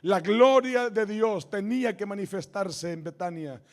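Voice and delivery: fervent sermon climb; preaching. Real, not acted. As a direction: drive the promise home to the church. Pastor builds to declaration.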